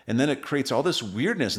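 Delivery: ordinary talk, nothing marked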